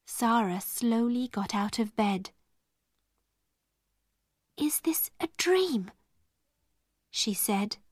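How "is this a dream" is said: high, half-whispered amazement; hushed wonder. A narrator's reading. Sara seeing her room transformed, in hushed wonder. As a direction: ask it softly, awestruck.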